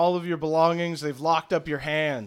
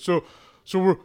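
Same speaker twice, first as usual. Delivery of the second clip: louder, slightly raised nervous bargaining; Thaddeus; 3 semitones higher, 8 dB louder than usual. Acted. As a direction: talk your way out of trouble. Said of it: "And Thaddeus goes".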